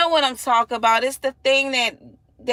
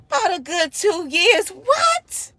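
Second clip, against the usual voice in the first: a mom stunned by a hamster's two-year lifespan; shocked disbelief; 9 semitones higher than usual. Retold or imitated. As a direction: react like you can't believe it. Shocked at coworker's answer.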